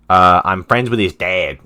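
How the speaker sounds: loud, drawn-out cover story; blurted excuse. Acted. Explaining to a cop about the kid, loud drawn-out blurt. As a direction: blurt it slowly and too loudly.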